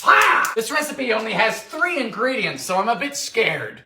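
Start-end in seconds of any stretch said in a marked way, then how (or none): 0.0-0.5 high comic scream; sudden high yelp; short scream. Acted. Comic scream after boiling step.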